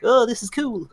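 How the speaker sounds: put-on high-pitched voice of an excited kid; little kiddie voice. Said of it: "In your little kiddie voice".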